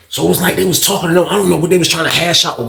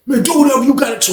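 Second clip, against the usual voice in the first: high, defiant holler; imitating a defiant yell; retold yelling; 8 semitones higher than usual. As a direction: yell it back as a dare. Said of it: "He's, he yelling".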